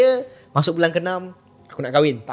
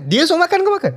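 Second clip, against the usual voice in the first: incredulous friend; 10 semitones higher, 5 dB louder than usual. Retold or imitated. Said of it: "kawan aku cakap".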